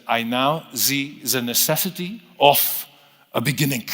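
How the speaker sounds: just a hint of a German accent, unhurried; Einstein's German accent. Lecturer quoting Einstein announcing to the press, light German accent. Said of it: "in his heavy German accent".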